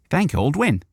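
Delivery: high, relieved voice; nearly faint with relief; relieved Friggins. Acted. Relieved Friggins thanks them.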